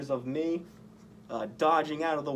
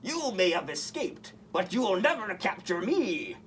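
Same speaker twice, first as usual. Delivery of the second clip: villain vow; 5 semitones higher than usual. A narrator's reading. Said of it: "the villain vowed".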